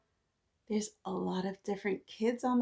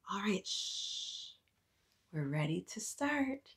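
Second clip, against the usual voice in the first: whispered all right and shh, slow; hushed; 9 dB quieter, slower than usual. Real, not acted. Teacher quieting the class before reading. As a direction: bring the room to a hush. Settling children for story.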